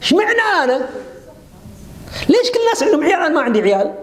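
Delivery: high, quick, aggrieved whine at fate; complaining woman. Retold or imitated. Preacher imitates a woman protesting against God's decree, high and whiny. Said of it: "احتجاج على قدر الله".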